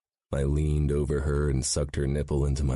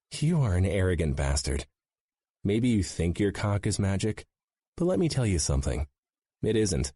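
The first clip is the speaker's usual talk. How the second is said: a touch higher, mocking woman; lightly voiced scornful woman; 5 semitones higher than usual. A narrator's reading. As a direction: scoff at him, lightly voiced. Gianna retorts, voiced higher.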